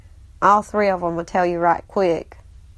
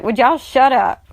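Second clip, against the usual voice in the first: louder, higher mock telling-off; retold exasperation; 5 semitones higher, 6 dB louder than usual. Stepmom tells laughing stepkids off, exasperated. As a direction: scold laughing kids, half in earnest.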